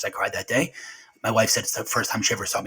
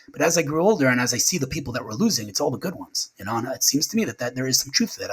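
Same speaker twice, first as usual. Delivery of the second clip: ordinary talk, nothing marked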